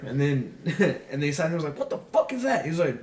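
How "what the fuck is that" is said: high-pitched incredulous outrage; retold outraged disbelief; pissed-off drill sergeant. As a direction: imitate a sergeant demanding an explanation. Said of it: "he got so pissed".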